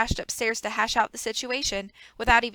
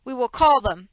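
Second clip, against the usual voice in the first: louder, forced-firm reply; trying to sound firm; 2 semitones higher, 7 dB louder than usual. Acted. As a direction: steel yourself and insist. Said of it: "in what he hoped was a firm tone".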